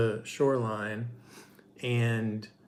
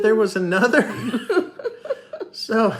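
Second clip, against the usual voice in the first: laughing through the words; 12 semitones higher, 7 dB louder than usual. Real, not acted. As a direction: burst out laughing in disbelief. Laughing in disbelief at dad.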